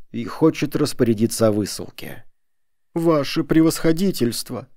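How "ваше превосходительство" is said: high-pitched plea; imploring. A narrator's reading. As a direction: beg an official for mercy. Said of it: "умоляюще начали они".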